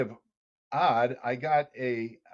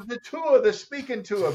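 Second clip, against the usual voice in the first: put-on Australian accent; 9 semitones higher than usual. Phoning late at night with the news, Australian accent. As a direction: announce it like a proud Aussie mate. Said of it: "this wild man talking to me".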